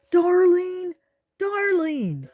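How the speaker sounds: high, loud frantic calling; frantic yell. A narrator's reading. Tor yells frantically for his lost friend, high and loud. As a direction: shout for her in panic. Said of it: "a frantic Tor yell".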